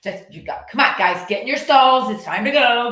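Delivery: hurried calling out. Retold or imitated. Owner rushing her horses into their stalls. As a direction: hustle the horses along impatiently. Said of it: "I get in a hurry and I'm like".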